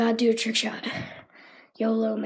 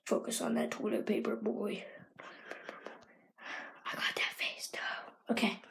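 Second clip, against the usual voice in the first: much softer, voiceless; whisper; 3 semitones lower, 13 dB quieter than usual. Real, not acted. Focusing on the trick shot, whisper. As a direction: coach yourself in a breath.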